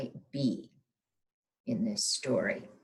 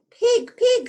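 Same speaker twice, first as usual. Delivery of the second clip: very high, loud call; calling Pig in the mud; 11 dB louder than usual. Acted. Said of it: "said Hen".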